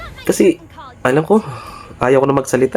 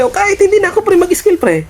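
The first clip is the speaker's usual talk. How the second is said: excited boast. Real, not acted. Gamer boasting to teammates mid-fight, excited and much higher. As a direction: crow that he needs no skills.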